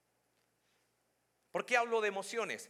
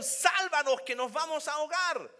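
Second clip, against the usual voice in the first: loud, high panicked shout; desperate cry; 10 semitones higher, 9 dB louder than usual. Acted. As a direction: cry out for rescue over the storm. Reading the disciples' cry.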